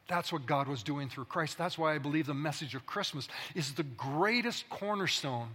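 ordinary delivery

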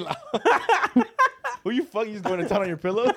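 high, cracking laugh through the words. Real, not acted. Laughing hard at the joke.

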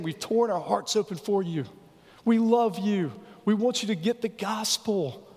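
a hint of Paul pleading; pleading apostle. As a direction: beg them to open their hearts. Voicing Paul's plea.

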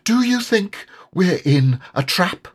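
high, nervous small voice; a timid little animal worried; nervous Piglet. A narrator's reading. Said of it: "he went on nervously".

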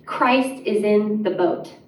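ordinary talk

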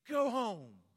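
loud, high, harsh two-word order; stranger's blunt retort. Retold or imitated. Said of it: "he leans into me, goes".

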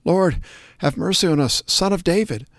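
high-pitched plea, calling out; crying out. A narrator's reading. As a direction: cry out for mercy, voice lifted high. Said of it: "cried out".

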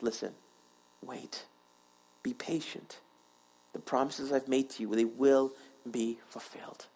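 low, hushed voice of God; quiet, patient promise fading to a near whisper; gentle divine reassurance. Acted. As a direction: reassure softly, pausing between phrases. God gently answers the waiting.

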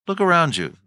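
ordinary talk, nothing marked

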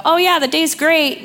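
high, bright, falsely chipper; fake cheerfulness. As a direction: pretend everything's fine, overdoing the cheer. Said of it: "stuff your emotion and go".

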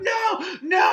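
high shouted no, hammed-up panic; mock scream. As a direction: yell it like a horror victim. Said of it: "And he's like".

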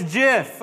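"gif" said as "jiff"; soft-g gif. Shown as a demonstration. Pastor repeating the correction, soft-g gif. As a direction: start "gif" with the J of jam. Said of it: "with a J".

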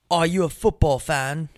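imitating a British kid; lightly put-on British accent. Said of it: "he was British. He had an accent".

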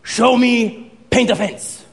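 slow, stern sensei order; Mister Miyagi. Retold or imitated. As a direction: command the pupil in drawn-out words. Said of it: "And he's like".

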